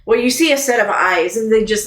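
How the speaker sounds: ordinary talk, nothing marked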